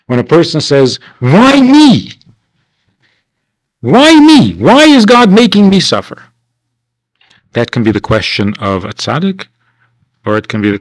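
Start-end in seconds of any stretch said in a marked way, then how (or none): 1.2-6.2 much higher, louder wailing plea; anguished cry. Retold or imitated. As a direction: cry out to the heavens. Sufferer crying out to God.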